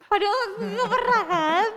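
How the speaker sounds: high, loud, drawn-out protest with a laugh; indignant cry breaking into laughter; exasperated protest. Real, not acted. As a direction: protest it loudly, half laughing. Protests a rumour about herself.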